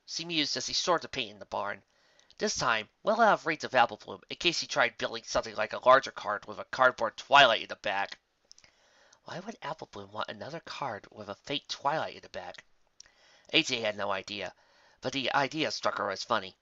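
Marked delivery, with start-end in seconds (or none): none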